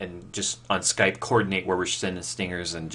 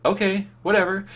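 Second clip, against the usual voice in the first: much higher, mock offhand teen; shrugging okay; 12 semitones higher than usual. Boy retells shrugging off sister's offer, mock careless. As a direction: toss it off like a bored thirteen-year-old. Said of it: "And I went".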